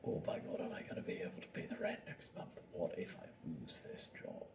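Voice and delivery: whispered, worried inner voice; anxious whisper. Acted. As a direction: whisper the fears to yourself. Voicing money anxiety.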